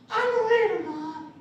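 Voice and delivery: put-on high, cracking little-boy voice; imitated young boy answering his mom; prepubescent crackling voice. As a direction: squeak it like a kid on tippy toes. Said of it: "In my prepubescent, crackling voice".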